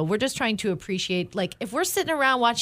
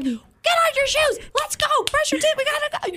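hurrying kids out the door, mock frantic; frazzled mom yelling. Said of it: "And I'm over here like".

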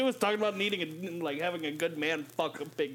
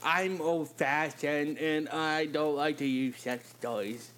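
slow mocking impression of the guy; mocking imitation; 2 semitones lower, slower than usual. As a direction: drag it out mockingly. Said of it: "This guy".

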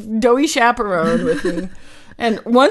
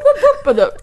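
laughing whoop noise; 12 semitones higher, 7 dB louder, faster than usual. Retold or imitated. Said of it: "It was the whoop".

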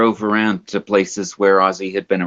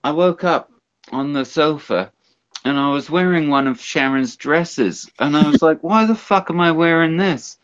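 imitated rocker telling a tale, pitched up; higher put-on rambling rock-star voice; 6 semitones higher than usual. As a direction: play the befuddled rocker, high and put-on.

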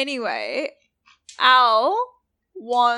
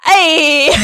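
loud, raised-pitch shout out a window; calling out; 5 semitones higher, 14 dB louder than usual. Acted. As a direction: yell to get their attention. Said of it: "She goes".

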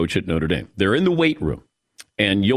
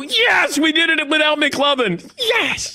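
mock victory shout. Host celebrating a mock award win, shouting. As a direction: cheer it like you just won.

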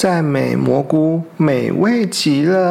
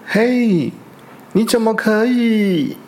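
higher startled protest; shocked outcry; 6 semitones higher than usual. Acted. Bear and weasel catch the fox stealing, a higher shocked outcry. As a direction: cry out at the thief.

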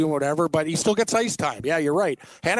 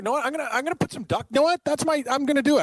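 excited; 10 semitones higher, faster than usual. Real, not acted. Excited decision, pitch jumps.

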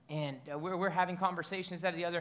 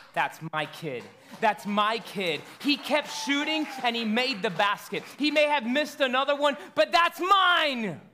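proud heavenly Father; 4 semitones higher, 5 dB louder than usual. Acted. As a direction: swell with fatherly pride, louder with each phrase. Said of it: "And He says".

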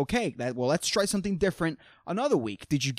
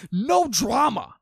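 mock complaining shout; 7 semitones higher, 3 dB louder, slower than usual. Real, not acted. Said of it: "This sucks".

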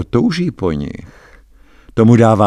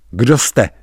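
higher, louder frightened cry; startled near-shout; almost a roar; 4 semitones higher, 6 dB louder than usual. A narrator's reading. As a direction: blurt it out, nearly roaring. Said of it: "vyrazil jsem skoro řvavě".

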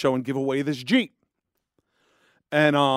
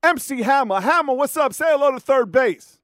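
pitched-up radio intro; radio announcer; 8 semitones higher than usual. Retold or imitated. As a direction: hype the special guest like a DJ. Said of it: "DJ introduces [name] on air".

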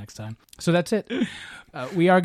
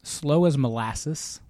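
Slow as Molasses said very slowly; drawn out slowly; much slower than usual. Shown as a demonstration. Host reading a saying to listeners, drawn out slowly. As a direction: stretch every word out like dripping molasses.

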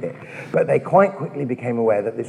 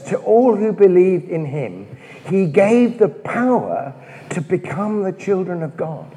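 scripture reading; 5 semitones higher than usual. Acted. Reads John's prelude aloud.

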